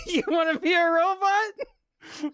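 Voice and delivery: high, slow, cracking up through the words; mocking the cardboard robot while laughing; laughing hard. Real, not acted. Laughing at Labo, asking if you want to be a robot.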